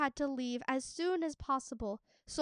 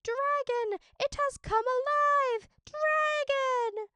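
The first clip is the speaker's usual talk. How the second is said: high, loud panicked screams; terrified screaming; 5 dB louder, slower than usual. A narrator's reading. Servants screaming as the dragon wakes, high and panicked. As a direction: shriek it out in terror. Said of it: "the servants screaming".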